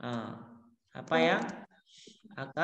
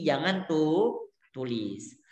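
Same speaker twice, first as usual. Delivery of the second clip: usual speaking voice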